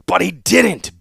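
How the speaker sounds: high, loud angry bellow. A narrator's reading. Warlord bellowing back at the Seneschal. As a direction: roar it back. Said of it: "bellowed the warlord".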